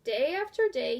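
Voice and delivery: ordinary delivery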